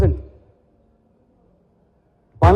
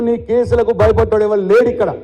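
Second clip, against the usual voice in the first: ordinary talk, nothing marked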